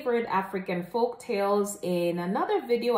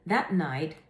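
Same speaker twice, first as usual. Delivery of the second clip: usual speaking voice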